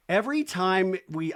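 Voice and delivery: ordinary delivery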